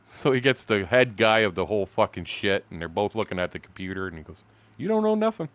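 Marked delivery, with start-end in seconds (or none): 4.8-5.5 high-pitched voice of a puzzled official; hammed-up bafflement, pitched way up; baffled supervisor. Head guy looking at the computer, mock bafflement. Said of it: "The look on his face like".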